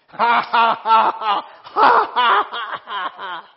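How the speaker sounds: loud forced laugh at trouble; mock laughter. Shown as a demonstration. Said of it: "You look at it and you say".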